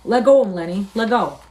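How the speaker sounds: loud call to stop; urgent order. Acted. George telling Lenny to release Curly, urgent and loud. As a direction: command it urgently, loudly.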